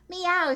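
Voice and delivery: high, loud meow; cat meow. Acted. Cat on the tractor, a high loud meow. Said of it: "said Cat".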